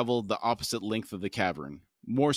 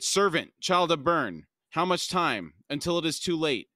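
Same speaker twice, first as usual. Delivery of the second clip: urgent shout; 6 semitones higher than usual. A narrator's reading. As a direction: raise the voice, urgent and pleading. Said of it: "He shouted".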